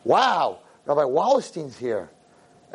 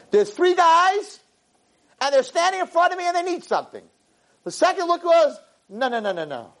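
high storytelling voice. Retold or imitated. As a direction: lift the voice high to paint it. Acting out Avraham's view.

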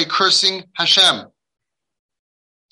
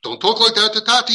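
raised, higher imitation of a parent; mock an offended parent's rebuke; indignant scolding; 7 semitones higher than usual. Father to screaming child, acted indignant scolding.

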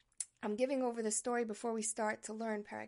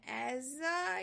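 ordinary talk, nothing marked

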